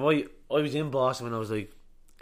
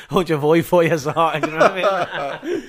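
real laughter breaking through the words, louder and higher; laughing; 6 semitones higher, 12 dB louder than usual. Real, not acted. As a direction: laugh your way through the line, slowing down. Laughing at his own remark.

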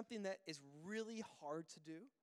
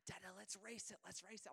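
imitated toddler's soft, higher plea; hushed little-kid voice; small child; 3 semitones higher, 6 dB quieter than usual. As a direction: coax softly like an eager three-year-old. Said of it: "he's kinda whispering".

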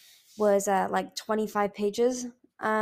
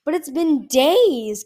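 slow, much louder alarmed cry; cried out; 6 semitones higher, 10 dB louder, much slower than usual. A narrator's reading. Kinkajou dismayed that Icicle hasn't slept, crying out. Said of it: "Kinkajou cried".

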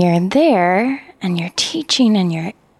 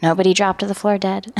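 ordinary talk

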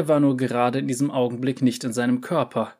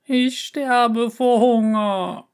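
high, slow, loud wail; dramatic starving wail; 11 semitones higher, 5 dB louder, much slower than usual. Acted. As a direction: howl with hunger. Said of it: "heulte Miauzka".

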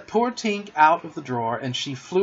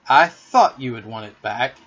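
louder, mildly resentful; a little bitter; 2 semitones lower, 6 dB louder than usual. A narrator's reading. Peter handing back the thimble, a little bitter. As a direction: say it with a touch of hurt. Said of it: "he said a little bitterly".

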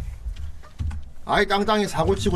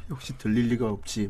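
despairing; 7 semitones lower, 7 dB quieter than usual. Retold or imitated. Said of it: "치와와가 절망하면서".

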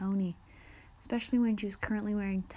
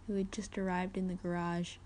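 ordinary delivery, nothing marked

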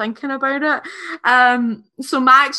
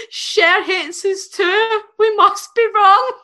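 high, loud, drawn-out mock wail; acted dismayed response; 7 semitones higher, 5 dB louder, slower than usual. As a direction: deliver it as an overdramatic punchline. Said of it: "and she responds".

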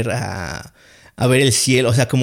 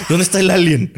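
higher, louder, acted urgency; retold urgent demand; 7 semitones higher, 5 dB louder than usual. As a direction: play waking up desperate to find someone. Acts himself waking, demanding the alien. (imitando urgencia al despertar, agudo y fuerte)

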